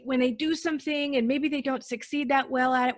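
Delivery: ordinary talk, nothing marked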